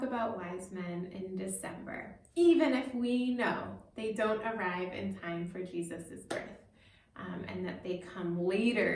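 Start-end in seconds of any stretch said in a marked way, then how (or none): none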